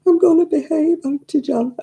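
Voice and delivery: put-on high little-boy voice, eager to please; a child promising to be good, pitched well up; imitating a small boy. Boy's reply voiced high.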